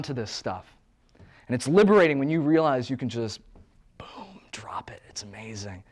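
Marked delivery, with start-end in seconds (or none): 4.0-5.8 hushed whisper of release; soft unvoiced whisper. Real, not acted.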